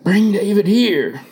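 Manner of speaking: ordinary delivery, nothing marked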